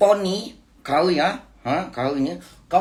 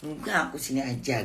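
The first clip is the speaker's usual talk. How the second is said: soft, softer sulky pleading; spoiled whiny voice; 2 semitones lower, 8 dB quieter than usual. Retold or imitated. Mother imitating her spoiled refusal to the boss. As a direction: sulk like a spoiled child, softly. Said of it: "ngomongnya kayak manja-manja gitu".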